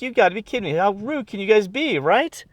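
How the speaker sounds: high-pitched mock outrage; indignant disbelief. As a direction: squeak it out in outraged disbelief at the rude fans. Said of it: "I was like".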